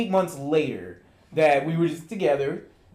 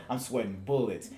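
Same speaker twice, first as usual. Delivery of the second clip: ordinary talk, nothing marked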